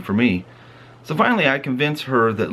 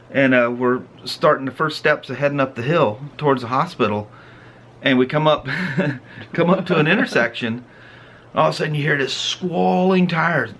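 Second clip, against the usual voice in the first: usual speaking voice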